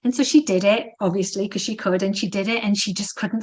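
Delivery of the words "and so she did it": usual speaking voice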